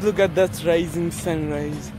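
ordinary talk, nothing marked

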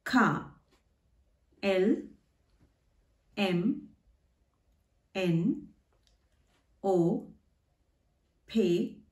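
each letter name alone, long pauses between; German alphabet names from K to V. Shown as a demonstration. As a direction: say "K" as kah, "V" as fow. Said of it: "pronunciation of the German letters".

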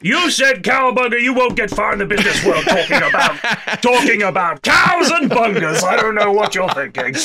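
a lightly hammed-up businessman scolding; mock stuffy boss. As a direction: lecture like a fusty boss. Mocking a stuffy business elder.

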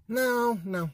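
ordinary talk, nothing marked

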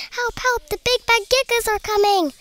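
high-pitched, mildly panicked plea; panted cry for help. A narrator's reading. First pea shooter fleeing the Gigas, crying help. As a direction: call for help high and urgent. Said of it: "panted the first little pea shooter".

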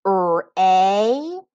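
"R-A-Z-O-R" as held separate sounds, with a buzzing z; sounding out. Shown as a demonstration. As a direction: tap through "R-A-Z-O-R" sound by sound. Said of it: "finger tap the sounds in each syllable".

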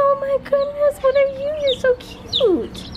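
high, slow cooing at chicks. Real, not acted. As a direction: coo at the tiny chicks.

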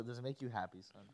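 soft, tender fatherly question; emotional dad. Retold or imitated. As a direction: ask it gently like a moved father. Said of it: "make it emotional".

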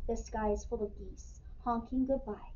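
ordinary delivery, nothing marked

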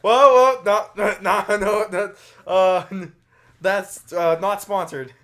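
loud, high alarmed protest; alarmed disclaimer. Real, not acted. Cohost cutting in loudly to deny sponsorship, alarmed. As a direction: jump in fast to deny a sponsorship.

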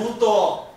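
emphatic ウと with a w glide; Okinawan wu sound. Shown as a demonstration. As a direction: say ウと as "wuutoo", drawn out. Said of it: "ウとウの違いがある".

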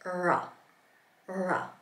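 "ra" with the tongue drawn back, not touching the roof; slow "ra" from further back in the mouth; curled-back R. Shown as a demonstration. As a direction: hold "ra" with a retracted, untrilled R. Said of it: "extend that tongue back".

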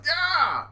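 high, loud cartoon yelp; yell when bitten. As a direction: yelp in mock pain. Henchman yells when bitten.

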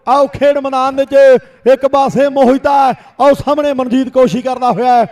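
rapid village kabaddi play-by-play; frantic rural sports commentary. Real, not acted. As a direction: call every raid live to the crowd. Second commentator calls raids. (ਤੇਜ਼ ਪੇਂਡੂ ਖੇਡ ਕੁਮੈਂਟਰੀ)

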